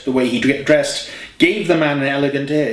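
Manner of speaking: plain reading voice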